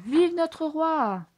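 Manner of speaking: slow, high, loud chant; crowd yelling. A narrator's reading. Subjects hailing Ratigan as their king, slow loud chant. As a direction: drag the cheer out like a whole crowd. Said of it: "hurle la foule".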